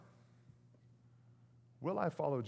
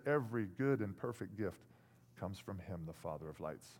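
much softer, lower reverent reading; hushed quoting of James; soft scripture quote; 8 semitones lower, 7 dB quieter than usual. Real, not acted. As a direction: drop down and read it gently.

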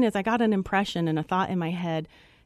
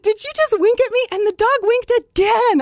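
excited; 13 semitones higher, 6 dB louder than usual. Retold or imitated. Retelling the dog winking again.

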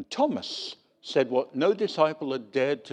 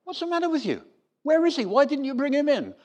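much higher, indignant demanding questions; exasperated authorities; 13 semitones higher, faster than usual. Retold or imitated. Religious authorities berating the guards, exasperated and high. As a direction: scold the guards for coming back empty.

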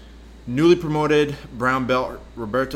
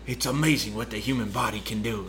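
slipping lightly into Australian; Australian accent; 3 dB quieter than usual. Retold or imitated. Retelling the doctor's amazement, light Australian accent. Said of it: "You broke into an Australian accent there".

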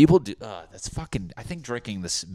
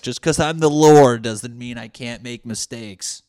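defensive Jesus; 4 semitones higher, 11 dB louder than usual. Retold or imitated. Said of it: "He's like".

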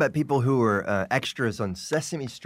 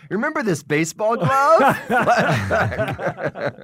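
mock sitcom setup; 10 semitones higher, 4 dB louder, slower than usual. Mocks a sitcom clip-show flashback cue.

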